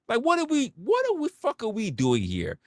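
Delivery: squeaky, high what are we; incredulous. Real, not acted. Asking nurses what they're doing, high and incredulous. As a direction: shoot way up in disbelief.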